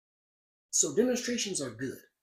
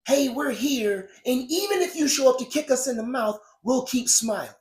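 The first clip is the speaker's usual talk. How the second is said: mock scream; 7 semitones higher, 6 dB louder than usual. Protester pleading with police to spare them, loud mock scream. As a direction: shout it like a meek protester begging to be liked. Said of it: "It's almost like screaming".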